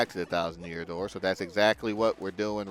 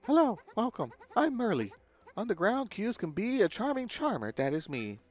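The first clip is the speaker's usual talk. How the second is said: high sing-song mystic voice; fortune teller; 7 semitones higher than usual. Acted. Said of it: "this is a fortune-telling shop".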